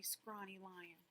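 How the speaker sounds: ordinary delivery, nothing marked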